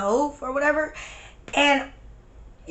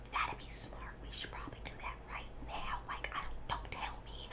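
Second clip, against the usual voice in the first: quick, hushed whispered reply; ASMR-like whisper; 21 dB quieter than usual. Retold or imitated. Agreeing to friend's escape plan, retold whisper. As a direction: whisper back as if in hiding. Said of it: "it was like S M R the whole time".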